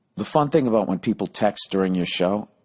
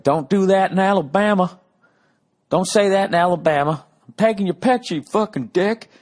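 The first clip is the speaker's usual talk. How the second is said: Alabama heckler; 11 semitones higher, 4 dB louder than usual. Retold or imitated. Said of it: "that was great".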